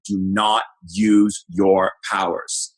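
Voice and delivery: slow, word-by-word mock command; retold stern warning. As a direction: imitate a father laying down the law, very slowly.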